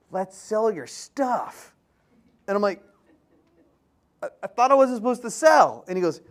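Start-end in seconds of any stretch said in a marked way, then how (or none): 0.1-1.7 drawn-out, slow, tempting offer; a hint of a sly mentor pitching an idea; slow sly suggestion. Mike casually proposes selling. 4.2-5.8 higher and louder, caught off guard; mock confusion at being told to sell; surprised protest. Confused reply to Mike.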